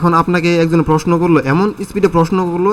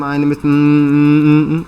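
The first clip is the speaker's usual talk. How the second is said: stalls on long drawn-out ums after the phrase; stuck for words, stretching every filler; a student hesitating with drawn-out fillers; hemming and hawing; 2 semitones lower, much slower than usual. Shown as a demonstration. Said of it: "filler word অথবা আমতা আমতা".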